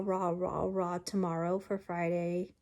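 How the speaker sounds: ordinary talk, nothing marked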